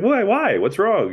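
lightly voiced A-Rod not seeing the problem; mock innocent puzzlement. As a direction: play him clueless and hurt.

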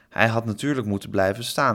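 plain reading voice

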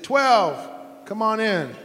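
loud, high call down the hallway; coach calling out. Retold or imitated. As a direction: shout his number to bring him in. Coach calls him into office.

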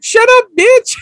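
high-pitched imitated snap; mock angry outburst. Samson shutting up his sister on the phone, high mock outburst. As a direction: cut the caller off in a high mock yell.

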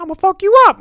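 very high, loud parrot squawk; mimicking parrot. Parrot copying the brother's threat, high mimic squawk. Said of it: "Paris be like".

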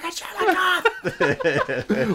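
high, drawn-out exasperated mock yell; listener yelling. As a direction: yell at the podcast like an outraged fan. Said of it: "someone's listening to this podcast, being like".

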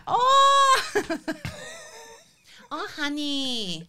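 put-on high laughing Thai accent; hammed-up Thai masseuse accent. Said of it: "Your Thai accent".

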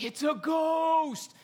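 high, loud, slow scared shout; frightened cry. Retold or imitated. Disciples seeing Jesus on the water, scared shout. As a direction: yell it like you saw a ghost. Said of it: "They're like".

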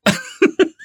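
high laughing disbelief; amazed laugh. Real, not acted. Host amazed at her own guess, laughing high.

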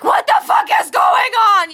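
high, loud alarmed yell; retold shout. Mother yelling at screaming kids in the car, high alarmed shout. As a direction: yell at the kids in the back seat. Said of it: "I'm like".

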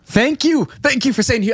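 high, excited outburst of gratitude; emphatic thanks. Real, not acted. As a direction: burst in, vindicated and grateful. Grateful outburst at movie mention.

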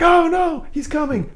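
very high, loud mock alarm; mock fright at anxiety coming round the corner; mock panic. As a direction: squeal it like a hammed-up scared kid. Mock fear of anxiety approaching.

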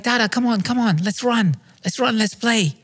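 put-on high-pitched, nagging toddler voice; imitated two-year-old son. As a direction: urge dad on like an impatient little kid. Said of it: "my two-year-old son, says".

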